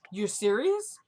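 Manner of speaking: questioning rise on "serious"; retold disbelief. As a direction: ask it with "serious" swinging sharply upward. Retold disbelief, rising second take.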